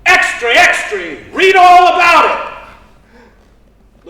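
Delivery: high, very loud street cry; long, drawn-out newspaper hawker's call; newsboy's yell. Acted. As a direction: holler the headline, stretching the words.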